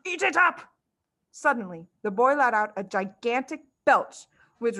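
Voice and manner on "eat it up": shouted order. A narrator's reading. Trunchbull shouting.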